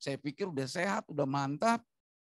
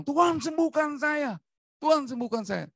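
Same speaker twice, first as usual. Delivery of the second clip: desperate shouted plea; 14 semitones higher, 8 dB louder than usual. Shown as a demonstration. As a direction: cry out to God at full voice, begging. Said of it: "saya tidak teriak seperti itu".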